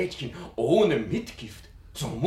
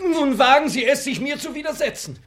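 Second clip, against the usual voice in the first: scolding; 10 semitones higher, 6 dB louder than usual. Acted. Servant scolding daughter.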